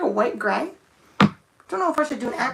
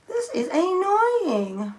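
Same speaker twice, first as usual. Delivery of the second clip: drawn-out whine; 10 semitones higher, much slower than usual. Real, not acted. Struggling with a thin applicator.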